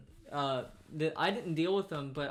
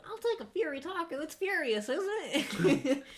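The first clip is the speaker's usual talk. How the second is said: mock customer; 11 semitones higher than usual. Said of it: "mocking the customer's misreading".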